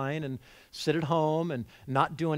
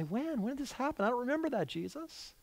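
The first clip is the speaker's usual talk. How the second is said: puzzled disciples; 9 semitones higher, 6 dB quieter than usual. Acted. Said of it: "And they're gonna say".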